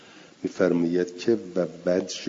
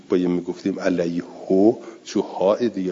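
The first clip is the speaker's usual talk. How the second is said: ordinary talk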